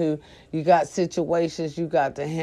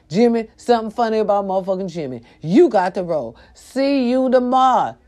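usual speaking voice

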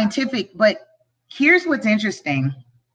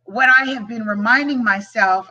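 ordinary talk

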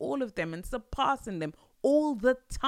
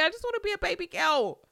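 high whiny wish; 9 semitones higher, 5 dB louder, faster than usual. Real, not acted. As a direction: whine it up high like a longing plea. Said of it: "said quietly earlier".

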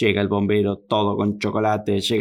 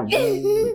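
high whoop. Acted. Watermelon flying.

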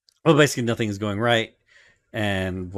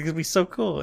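giddy Luffy; 8 semitones higher than usual. Retold or imitated. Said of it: "He's like".

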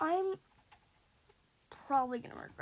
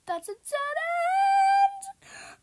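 high, loud, drawn-out shriek; panicked scream; 12 dB louder, much slower than usual. Real, not acted. Gamer trapped at a dead end, panicked scream. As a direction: scream as you hit the trap.

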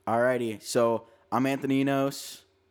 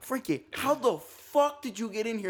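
high-pitched baffled demand; retold incredulity; 11 semitones higher than usual. Storyteller confronting Frankie inside the locked dorm, high-pitched disbelief.

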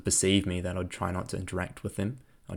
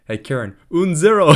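friends teasing in loud, high mock French; joking taunt; 10 semitones higher, 14 dB louder than usual. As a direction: call out a mock-French tease, rising louder. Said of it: "my friends jokingly".

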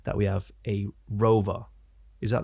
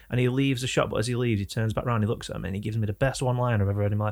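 usual speaking voice

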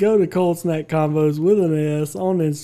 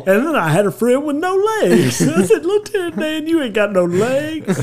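mock Forrest Gump; 8 semitones higher than usual. Forrest Gump impression continues.

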